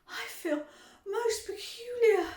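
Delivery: high, slow gasping; gasped. A narrator's reading. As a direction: gasp the words out. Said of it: "gasped Violet".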